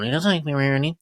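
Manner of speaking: normal talking voice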